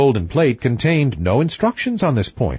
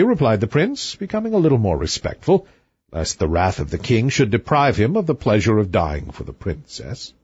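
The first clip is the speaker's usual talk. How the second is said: ordinary delivery, nothing marked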